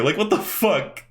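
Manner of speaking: usual speaking voice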